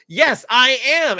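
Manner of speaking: put-on the kid still fuming insists; upset kid. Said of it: "Or they're gonna say".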